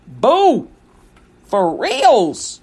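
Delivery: playful, drawn-out slang sign-off; slangy emphasis. Acted. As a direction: punch the slang to make a point. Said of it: "Why are you talking like that?".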